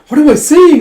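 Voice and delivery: very high, loud fluster; mortified outburst. Retold or imitated. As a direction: squeal it in mock panic. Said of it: "she just blushes with steam".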